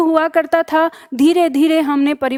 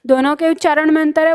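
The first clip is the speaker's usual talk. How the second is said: ordinary talk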